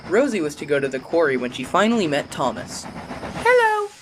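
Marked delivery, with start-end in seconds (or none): 3.4-3.9 very high, sweet little engine hello. A narrator's reading. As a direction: chirp a bright hello. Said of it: "she greeted the little blue engine".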